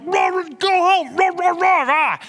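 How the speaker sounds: high loud slow mock barking and yelling; barking shout. Pastor barking and yelling go home at the dog. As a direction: roar back at the dog. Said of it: "And I'm like".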